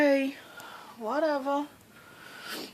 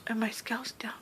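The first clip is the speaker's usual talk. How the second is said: excited whisper; 14 dB quieter than usual. Real, not acted. Excited about scale drop.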